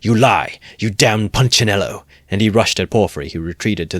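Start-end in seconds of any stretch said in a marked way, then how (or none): 0.0-2.0 loud furious roar. A narrator's reading. Raskolnikov accusing Porphyry of lying, loud furious roar. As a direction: let it burst out unrestrained. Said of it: "roared Raskolnikov without restraint".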